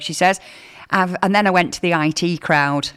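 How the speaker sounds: normal talking voice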